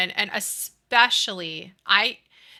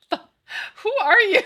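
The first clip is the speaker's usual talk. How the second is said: high, slow drawn-out who are you; mock disbelief; 13 semitones higher, 8 dB louder, much slower than usual. Mother to son who isn't excited, mock shocked. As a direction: act stunned at your own kid. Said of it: "And I was like".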